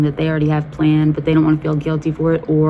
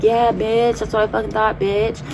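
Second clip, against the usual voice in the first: mocking taunt; 5 semitones higher than usual. Taunting the girl as she walks away, gloating. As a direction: crow over her as she walks off.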